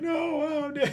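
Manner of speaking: very high, loud mock child wail; wailing kid. Kid watching father collapse, high hammed-up wail. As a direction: squeal it like a distraught child.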